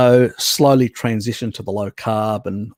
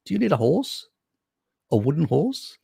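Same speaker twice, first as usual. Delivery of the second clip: lightly voiced Greek trader, high on the offer; a hint of a sly merchant pitching a wooden horse; Greek horse seller; 7 semitones higher than usual.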